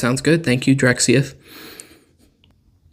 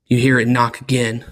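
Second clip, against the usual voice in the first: game master narration. A narrator's reading.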